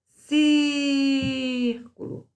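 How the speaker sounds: "Círculo" with a very long, high "Cír"; stressed syllable drawn out. Shown as a demonstration. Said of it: "antepenúltima".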